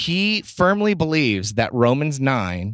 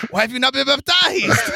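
put-on high shouted accented quote; Nacho Libre voice; 11 semitones higher than usual. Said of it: "as a baptism announcement".

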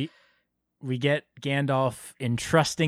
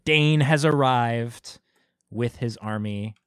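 ordinary talk, nothing marked

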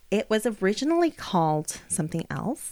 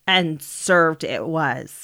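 slow dramatic; 6 dB louder, much slower than usual. Pretend. Dramatic echo of justice served.

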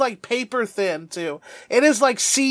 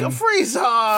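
mock scolding call. Scolding Frieza like a pet.

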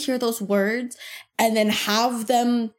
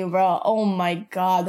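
ordinary talk, nothing marked

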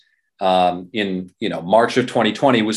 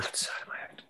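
whisper; 21 dB quieter, faster than usual. Real, not acted.

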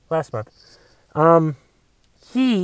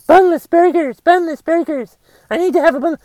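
much higher, whiny mock chant; mocking high whine; 10 semitones higher than usual. Comic mocking bunless burger eaters, whiny high. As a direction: whine it like a spoiled brat.

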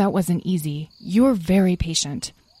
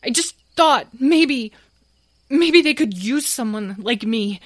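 crying despair; 11 semitones higher, 6 dB louder than usual. Acted. Tom breaks down before sobbing.

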